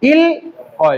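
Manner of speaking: ইল with a clear l, then অয় apart; both consonants sounded. Shown as a demonstration. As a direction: pause between ইল and অয়. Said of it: "দুইটাই উচ্চারণ হবে".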